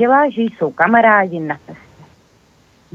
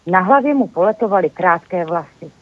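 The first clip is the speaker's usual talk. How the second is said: ordinary delivery, nothing marked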